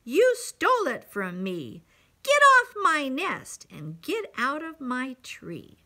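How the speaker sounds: scolding storybook accusation; mildly indignant bird. A narrator's reading. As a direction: order them off with indignation. Bird angrily orders Horton away.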